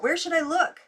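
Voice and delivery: high, loud, frightened plea; rising panic, loud; shrill and trembling. A narrator's reading. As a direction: let it ring high and anxious. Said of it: "rang shrill and trembling".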